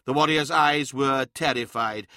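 normal talking voice

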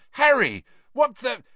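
high, loud astonishment; startled. A narrator's reading. Hagrid finding Harry beside Dumbledore, startled. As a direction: burst out high and break off.

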